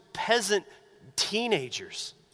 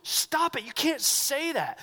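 sharp, higher scolding hush; hushing rebuke; 6 semitones higher, slower than usual. Retold or imitated. Crowd hushing the blind men.

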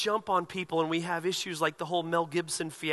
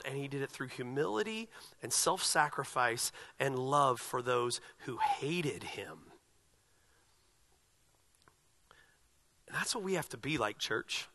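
hushed sermon; 3 semitones lower, 6 dB quieter than usual. Acted. Pastor closing a point to the church, hushed and tender. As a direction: drop to a near whisper.

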